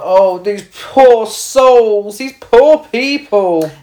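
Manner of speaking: high, slow, drawn-out pity; hammed-up sorrowful sigh; mock pitying Doug. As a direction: wail it like a pitying onlooker. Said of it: "he's like".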